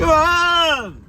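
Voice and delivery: loud high call; shouting. Real, not acted. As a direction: yell it across the field. Driving cows, calling out.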